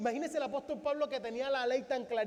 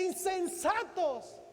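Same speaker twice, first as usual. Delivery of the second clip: slow rebuke; 3 semitones higher, 3 dB quieter, much slower than usual. Acted. Jesus rebukes disciples.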